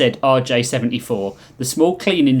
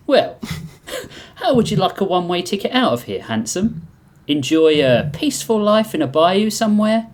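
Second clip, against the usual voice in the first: high, lilting gangster pitch; wheedling mob boss; 8 semitones higher than usual. Acted. Little mob boss offering reptile an escape, high and cocky.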